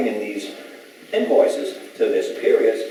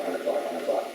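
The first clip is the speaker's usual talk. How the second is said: fading repetition; 3 semitones lower, 9 dB quieter than usual. Real, not acted. Fading repeat of round the clock.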